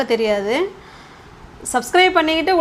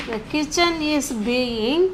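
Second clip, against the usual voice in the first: softer, very slow, rising at the end; slow dictation; 5 dB quieter, much slower than usual. Shown as a demonstration. Teacher repeating the sentence so far, slow softer dictation. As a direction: stretch each word while building the sentence.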